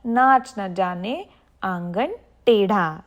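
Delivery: ordinary talk, nothing marked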